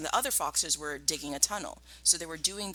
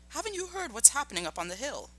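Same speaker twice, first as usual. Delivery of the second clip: higher-pitched, urgent question; excitedly; 9 semitones higher, 4 dB quieter, faster than usual. Acted. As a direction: burst out with the news, worked up. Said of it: "Badger said excitedly".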